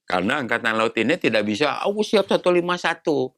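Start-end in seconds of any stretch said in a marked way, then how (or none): 1.8-3.3 raised pitch for the quoted claim; mock readiness claim. Navy supposedly declaring itself ready, high-pitched mock quote. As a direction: quote it high, as someone else's words.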